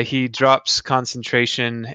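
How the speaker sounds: ordinary talk, nothing marked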